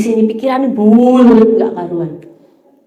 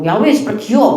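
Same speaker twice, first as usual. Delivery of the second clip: sister grudgingly allowing. Retold or imitated. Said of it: "adikku tuh kalau ngomel".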